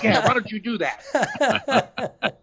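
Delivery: slow, high, laughing sarcasm; sarcastic retort. Retold or imitated. Sam sneering at the girl who went to get her dad. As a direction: drawl it out mockingly.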